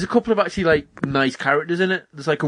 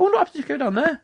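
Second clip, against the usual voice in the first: much higher, light self-quote; breezy retold self; faster than usual. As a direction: sing it out high, brushing the warning off. Retells own carefree decision in game.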